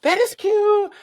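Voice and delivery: recognising a friend on TV, overjoyed; thrilled squeal. Retold or imitated. Spotting Q on morning TV, a high thrilled squeal. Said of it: "going through all the motions".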